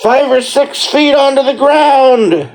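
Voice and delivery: shocked. Retold or imitated. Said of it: "You drop us like".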